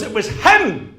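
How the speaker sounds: loud, high pointed accusation; accusing shout. Retold or imitated. Head of the house to bishop, accusing shout. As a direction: cry out as you single out the culprit. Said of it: "Like that".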